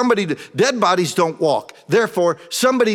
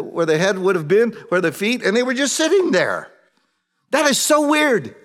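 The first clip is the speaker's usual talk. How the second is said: normal talking voice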